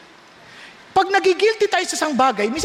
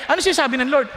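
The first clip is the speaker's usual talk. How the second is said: normal talking voice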